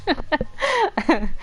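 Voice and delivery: real laughter creeping into the words; amused laughing. Real, not acted. Player teasing Sayori over her luck, amused laughing. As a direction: break into a laugh at the end. Amused aside while reading aloud.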